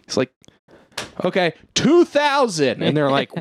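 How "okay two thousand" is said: high, drawn-out mock bidding call; imitating a troublemaker at auction, pitched high; obnoxious overbid. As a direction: call out an absurd bid, slowly. Heckler shouting absurd bids.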